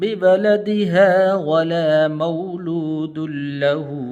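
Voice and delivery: full tajweed recitation with long held vowels; Quran recitation. Acted. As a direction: recite the whole passage as a flowing chant. Full recitation after the lesson.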